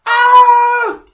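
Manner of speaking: high loud startled yelp; a sudden, shrill squeal of fright; frightened reindeer cry. Acted.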